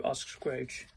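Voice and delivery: plain reading voice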